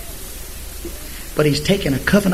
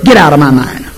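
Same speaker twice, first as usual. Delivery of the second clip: firm rebuke; 2 semitones lower, 7 dB louder than usual. Pretend. Rebuking worry thoughts.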